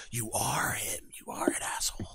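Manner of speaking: soft, voiceless whisper aimed at someone on screen; accusing whisper. Retold or imitated. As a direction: whisper the accusation under your breath. Mutters at man in videos.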